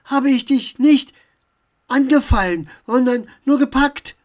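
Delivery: loud, high, scolding correction; huffy elf defending herself; indignant retort. A narrator's reading. Elf carries on the quarrel.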